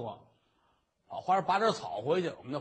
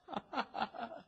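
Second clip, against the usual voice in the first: relieved laugh; 6 semitones higher, 10 dB quieter than usual. Acted.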